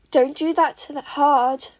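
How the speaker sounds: loud sharp warning. A narrator's reading. Mei warning Katsuki from the back, loud. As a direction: call out a warning. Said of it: "Mei warned".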